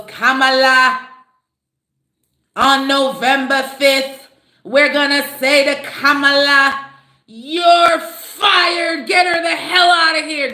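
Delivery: loud, slow, drawn-out rally chant; Trump rally voice. Retold or imitated. Imitating Trump at a rally, hammed-up loud crowd-pleasing shout. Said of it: "when he gets on the stage and he goes".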